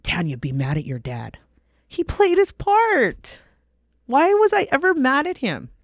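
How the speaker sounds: lightly voiced tempter, rising high; hammed-up devil taunting her; mock devil's voice. The devil goading Tanya to stay mad, mock tempting. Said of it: "the devil never wants you to".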